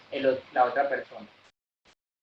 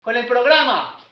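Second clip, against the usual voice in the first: much higher, louder, fast calling out; loud caller; 10 semitones higher, 8 dB louder than usual. Shown as a demonstration. A hard-of-hearing lady calling to ask for help loudly. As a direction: shout it to be heard. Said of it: "la señora es sordita".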